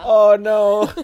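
oh no entre risadas agudas; rindo. Real, not acted. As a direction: solta uma risada alta de susto fingido. Reage rindo à provocação.

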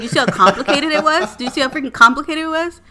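loud exasperated rant, a bit fast; frustrated yelling. Retold or imitated. Wife ranting to husband about the complicated app, loud and frustrated. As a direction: blow up at him in disbelief.

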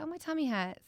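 small quiet whine, imitating a child; child's complaint. Mum retelling her daughter's repeated tummy complaint, soft childlike whine.

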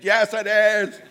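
high-pitched insistent comeback; hammed-up contradiction; arguing caller. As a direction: snap back in a mimicked high voice. Caller arguing back, retold.

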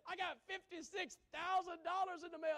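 testimonial lady. Retold or imitated. Preacher mimics a TV testimonial lady, high and loud. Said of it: "The other lady said".

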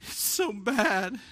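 high, slow mock-tearful voice; drawn-out, high-pitched pretend crying; imitated weeping child. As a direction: imitate a daughter sobbing out a confession. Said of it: "she's weeping and crying".

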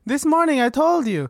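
high-pitched secretary arguing her case; put-on high voice, insistent; pleading secretary Sasha. Secretary argues with mediator, high insistent voice.

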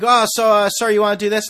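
pushy fundraiser, loud and high; loud eager pitch. Retold or imitated. Street fundraiser pitching loudly.

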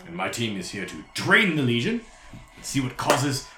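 animated, swooping lecturer-devil voice; mannered gentleman scholar holding forth; courtly devil explorer. Acted. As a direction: explain the expedition with theatrical relish.

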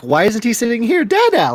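put-on high, rising toddler plea. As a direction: whine it like a little kid. Toddler voice quoted.

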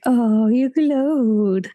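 very slow, dragged-out mock admiration; sly knowing drawl. Readers reacting to the couple who glowed, slow and sly. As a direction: stretch it out like a wink. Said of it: "and we're all like".

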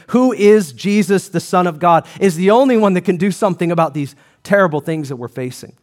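ordinary delivery

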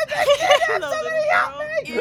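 high, slow, creepy sing-song hello; mock the creepy greeting, drawn out. Player mocking Tarkus's creepy hello to the girl. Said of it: "Fucking creepy motherfucker".

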